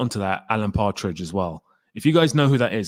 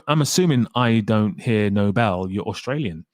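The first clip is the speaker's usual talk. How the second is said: ordinary delivery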